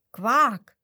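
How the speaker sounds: loud high "Quak" croak; imitated croak. Squirrel showing how frogs croak, loud mock croak. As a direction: mimic a frog's quack.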